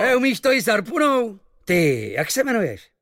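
high, loud admiring sailor voice; impressed sailor. Acted. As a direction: marvel at the shot. Same sailor.

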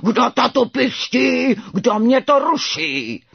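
loud, high, slow roaring; full-force drawn-out beast roar; ferocious tiger roar. A narrator's reading. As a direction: bellow each word like a furious tiger. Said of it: "zařval ukrutněji než tatínek".

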